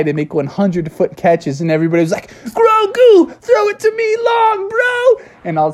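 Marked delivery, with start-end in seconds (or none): 2.4-5.2 very high, excited call for the ball; shrieky cartoon kid plea; clamouring kids. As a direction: call out squeakily across the field.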